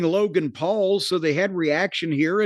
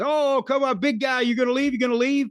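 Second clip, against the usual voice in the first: taunting; 6 semitones higher, faster than usual. Retold or imitated. Logan Paul taunting departing Gunther.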